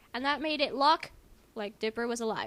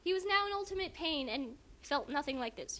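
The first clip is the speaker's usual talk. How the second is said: normal talking voice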